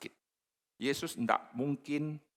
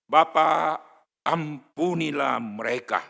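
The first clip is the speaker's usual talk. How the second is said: earnest plea; 5 semitones higher, 10 dB louder, slower than usual. Acted. Quoting Jesus on the cross.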